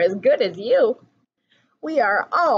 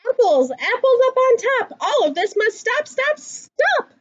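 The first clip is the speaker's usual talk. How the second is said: frantic story reading; 11 semitones higher than usual. A narrator's reading. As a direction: raise the alarm as the bear chases them. Frantic chase in picture book.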